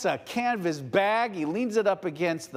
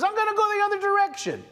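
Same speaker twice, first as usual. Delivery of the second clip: Jonah running off; 12 semitones higher, 4 dB louder, much faster than usual. Acted. Jonah quoted fleeing God.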